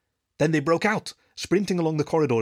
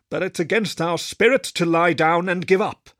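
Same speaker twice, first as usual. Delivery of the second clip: higher and louder, stubborn; defiant old wolf; 6 semitones higher, 5 dB louder than usual. Acted. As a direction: refuse to give up, voice lifted. Wolford's defiant line.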